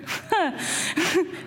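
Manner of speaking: short high laugh; real laugh. Real, not acted. Preacher laughing at self while relating to disciples, short high laugh. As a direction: laugh at yourself.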